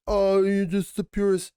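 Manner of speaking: high, cooing sarcastic gush; simpering mock fan. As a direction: parody the adoring fans. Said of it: "they're like".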